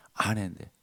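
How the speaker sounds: 아내인데 dropped low and quiet; soft echo. Shown as a demonstration. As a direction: let 아내인데 fall away softly. (아내인데를 조용히 낮춰서)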